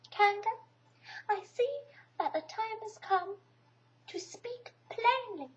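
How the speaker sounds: high, slow, trying to sound brave; a tiny animal puffing himself up; brave little voice. A narrator's reading. Piglet standing up to Kanga, high brave little voice. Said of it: "in as brave a voice as he could".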